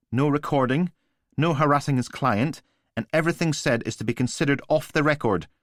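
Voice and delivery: a clipped desk sergeant; stern sergeant voice. A narrator's reading. Sergeant Price dialogue.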